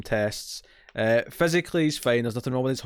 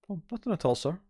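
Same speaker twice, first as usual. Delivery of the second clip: high, softer feigned innocence; innocent Data; 6 semitones higher, 5 dB quieter than usual. Retold or imitated. Data denying to the captain, high mock innocence. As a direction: play it falsely innocent, a touch softer.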